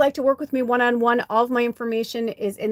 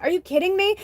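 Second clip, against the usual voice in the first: a high, outraged what-the-heck; exasperated disbelief; 11 semitones higher than usual. Retold or imitated. To herself after pulling her shoulder, high incredulous squeak. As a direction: shoot way up in pitch, incredulous.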